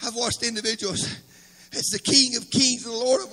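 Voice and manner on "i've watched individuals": normal talking voice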